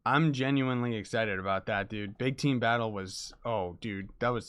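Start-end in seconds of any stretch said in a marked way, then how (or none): none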